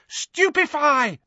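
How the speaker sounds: very high, stretched spell cry; shouted spell. A narrator's reading. Neville taking aim again, high-pitched shout.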